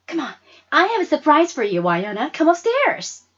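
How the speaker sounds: high, loud and fast, bubbling over; eager friend. Acted. Friend pulling Wynonna upstairs to a surprise, eager and fast. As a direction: rush the words out, can't wait to show.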